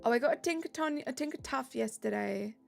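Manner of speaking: ordinary talk, nothing marked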